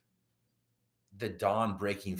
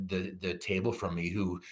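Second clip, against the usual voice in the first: ordinary delivery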